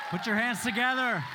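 excited hype call. Real, not acted. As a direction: pump up the audience. Host hyping crowd.

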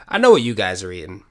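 usual speaking voice

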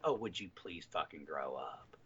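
a weary mom's put-down; a low, soft, exasperated mom line. Retold or imitated. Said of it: "she turned around and said".

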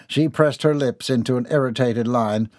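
ordinary delivery

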